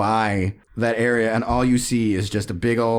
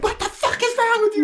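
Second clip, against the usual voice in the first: much higher, yelling at the driver; outraged kids. Retold or imitated. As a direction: shout it up at the bus. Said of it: "we're all like".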